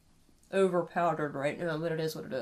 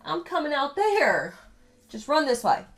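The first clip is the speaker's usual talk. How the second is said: playful yelling; 7 semitones higher, 9 dB louder than usual. Real, not acted. Playfully chasing daughter off seat.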